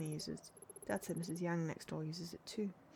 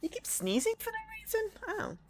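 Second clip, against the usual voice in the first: squeaky voice; 4 dB louder than usual. Acted. High squeaky reaction to sneezing.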